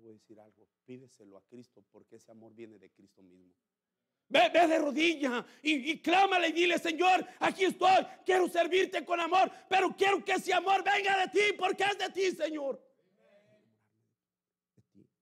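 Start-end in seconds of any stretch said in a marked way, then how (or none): 4.3-6.8 fiery preaching. Acted. Preacher urging the flock to pray, shouting at full force. 6.8-12.8 high, loud cry to the Lord; shouted prayer. Pretend. Believer kneeling before the Lord, crying out loudly.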